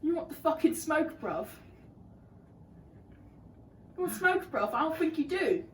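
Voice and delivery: put-on British accent, squaring up to someone. Girlfriend showing off her British accent with a tough Vine line. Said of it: "in a country accent ... a British accent".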